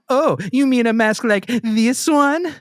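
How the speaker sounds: put-on high, slow anime girl voice; overacted anime dub. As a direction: ham it up like an anime character. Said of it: "You sound like an anime character".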